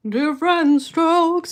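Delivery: slow, loud, high [name]-style crooning; [name] impression. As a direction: belt it out slowly like [name]. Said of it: "That's my [name] impression".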